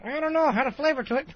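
high, silly puppet voice; squeaky little puppet character; Yogurt Liddy voice. Acted. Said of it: "Liddy, what type of yogurt".

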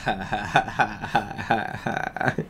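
soft staged cackle; quiet, breath-driven mock evil laugh; mean laugh. Snape gloats over his captives, mean mock laugh. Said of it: "Snoop laughed meanly".

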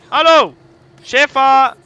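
loud, high hail; calling out. Real, not acted. Player hailing the boss in the game, loud shout. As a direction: shout to get their attention.

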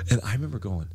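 normal talking voice

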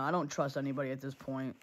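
ordinary talk, nothing marked

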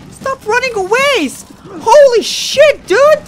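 real shocked gamer yell; frustrated shout. Real, not acted. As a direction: scream at the fleeing enemy. Enemy flees in game.

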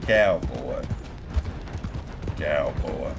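low, raspy cowboy voice, lightly done. Shown as a demonstration. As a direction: growl it like you've been yelling at cattle all day. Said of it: "All raspy".

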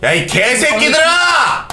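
high, slow yell at teammates; angry shout. Real, not acted. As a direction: bellow it to get them to focus. Teammates not focusing, yells at them.

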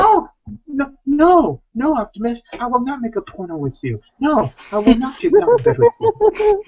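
high-pitched protesting impression; Mark Wahlberg. Actor refusing Optimus's offer, high-pitched protest. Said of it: "impression of Mark Wahlberg".

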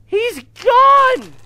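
sudden high, loud cry of alarm; real startled shout. Real, not acted. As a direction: yell it out in panic. Panicked horror game reaction.

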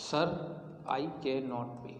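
teacher dictating to students; dictating word by word. Shown as a demonstration. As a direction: dictate it piece by piece so they can write. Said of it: "teacher dictates sentence with pauses".

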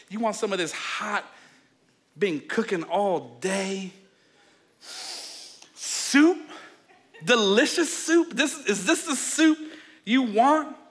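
mock-innocent, rising questions; taunting tease. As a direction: dangle the soup in front of him. Jacob taunts hungry brother with soup.

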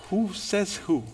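quiet challenge. Acted. Quiet challenge after the quote.

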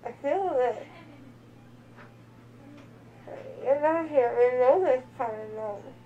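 slurred words, numb lip; groggy after anesthesia, mouth numb; numb from the dentist. Real, not acted. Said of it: "so you feel your lip now".

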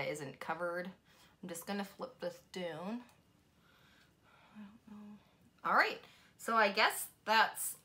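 talking through a face mask; wearing a sheet mask. Real, not acted. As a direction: fingers near lips. Seen 2: Sheet mask covers face; fingers adjust it at mouth while speaking.

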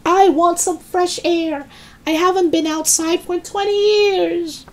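put-on very high, screechy old woman's demand; defiant shout. A narrator's reading. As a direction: cry it out, insisting. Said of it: "she shouted".

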